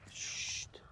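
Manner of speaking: soft hushing whisper; whispering over a crib. Real, not acted. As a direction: hush it gently. Hushing near sleeping animal.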